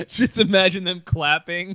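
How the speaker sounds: laughing through the words. Real, not acted. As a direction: crack up as you say it. Laughing at Peter Pan image.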